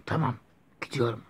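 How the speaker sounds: ordinary talk, nothing marked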